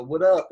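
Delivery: "what up" with a loud, punched "up"; "what up" thrown onto its last word; stress on UP. Shown as a demonstration. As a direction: skim "what" and hit "up" in "what up". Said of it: "emphasis on the second syllable of the word".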